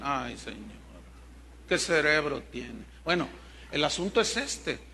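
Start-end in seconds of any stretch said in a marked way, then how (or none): none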